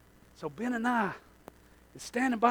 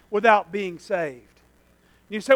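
ordinary delivery

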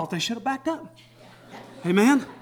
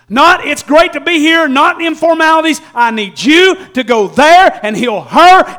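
loud, high pleading prayer; desperate father pleading; 8 semitones higher, 10 dB louder than usual. Retold or imitated. Father begging Jesus to heal his daughter, loud and high. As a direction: beg Jesus to come now. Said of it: "he prayed right".

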